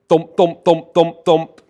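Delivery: loud, evenly spaced engine thumps; imitated engine thumping. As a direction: thump it out like a big piston. Imitates big single-cylinder engine thumping.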